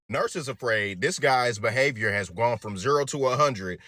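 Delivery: ordinary delivery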